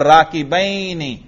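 "राकिबैनी" with a drawn-out ai glide in -baini; pronounced with ya. Shown as a demonstration. Teacher proves nasb by pronunciation, "राकिबैनी" with ai glide. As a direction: stretch the ya in "राकिबैनी" as -bai-ni. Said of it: "मैं या पढ़ रहा हूं".

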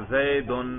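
"زیدن" ending in -un; "زیدن" with a u before the final n; nominative ending. Shown as a demonstration. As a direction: say "زیدن" as zaidun. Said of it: "تینوں طرح اعراب اس پر آتے ہیں".

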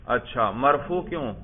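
ordinary delivery

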